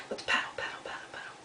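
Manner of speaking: soft rhythmic whisper. Pretend. Storyteller getting listeners to paddle along, whispering.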